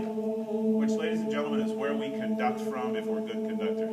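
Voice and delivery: choir rehearsal coaching. Acted. Choir director to singers, coaching in a half-sung voice. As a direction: coax the choir into a round, belly-supported sound.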